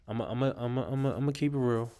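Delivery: repeating words, stuck then rushing; stuttering. Real, not acted. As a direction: stammer before blurting it out. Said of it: "why I'm stuttering over this".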